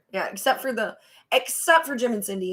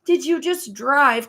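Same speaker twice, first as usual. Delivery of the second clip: high, louder incredulous demand; exasperated scolding; 8 semitones higher, 4 dB louder, slower than usual. Acted. Viewer scolding Cindy for driving to Glenn's, exasperated. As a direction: drag out the question in mock outrage.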